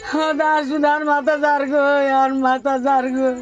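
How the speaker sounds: slow grieving wail; hit hard crying out. Real, not acted. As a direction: lament it, slowly drawn out. Grieving mother recounting son's death.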